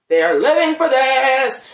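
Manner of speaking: loud, high, drawn-out rave; over-the-top gushing. Retold or imitated. Fan raving about the actresses' scene, loud hammed-up gushing. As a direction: belt it out slowly in theatrical delight. Repeats gushing praise, escalated.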